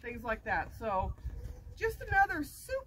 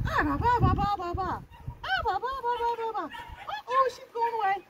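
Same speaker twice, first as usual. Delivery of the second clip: high babbling call; playful puppy call; 5 semitones higher than usual. Shown as a demonstration. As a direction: babble to win her back. Calling puppy back.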